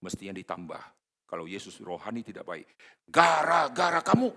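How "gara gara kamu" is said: higher, louder jab, a blaming tone; mock accusation. Preacher imagines Jesus blaming the crowd, mock accusing. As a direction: fling the blame at them.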